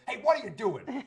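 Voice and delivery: high, loud mock shout across a street; retold cop yell. A cop yelling across the street at a man stopping traffic, mock shout. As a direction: bark it like a cop from across the road. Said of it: "a cop across the street yelling at me".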